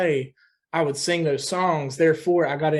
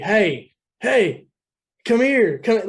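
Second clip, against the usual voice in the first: higher, louder call to a friend; calling across the street; 5 semitones higher, 4 dB louder, much slower than usual. Retold or imitated. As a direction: shout it over to a friend across the road. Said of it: "yelling at a friend across the street".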